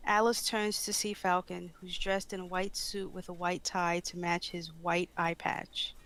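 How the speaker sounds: ordinary delivery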